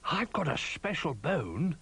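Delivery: high, slow dog character voice; Ruff, helpful. A narrator's reading. Ruff offering his bone to friends, helpful. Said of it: "said Ruff helpfully".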